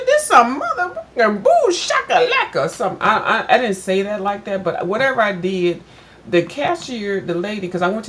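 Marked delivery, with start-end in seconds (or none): 0.1-2.7 high, louder mock outrage at the price; exaggerated indignant rant, much higher pitched; hammed-up outraged cussing. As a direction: play it up as a comic rant about being overcharged. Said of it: "I said".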